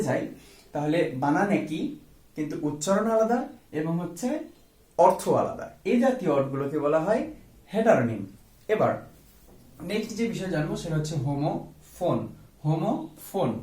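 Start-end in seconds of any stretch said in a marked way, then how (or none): none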